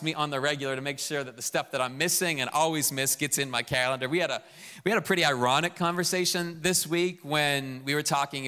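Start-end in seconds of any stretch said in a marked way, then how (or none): none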